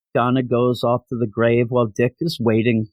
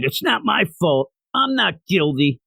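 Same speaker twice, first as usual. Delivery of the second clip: higher-pitched mock protest; protesting innocence; 6 semitones higher than usual. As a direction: imitate a convict pleading not guilty. Retold prisoner protesting innocence.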